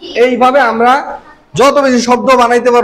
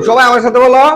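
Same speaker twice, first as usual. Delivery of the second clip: normal talking voice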